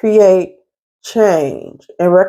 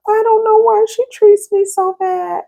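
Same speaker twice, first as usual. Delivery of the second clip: very high-pitched, plaintive imitation; pitched way up, far from your own voice; Loretta Devine impression. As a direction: mimic a squeaky-high woman's complaint. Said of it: "she has a high-pitched voice".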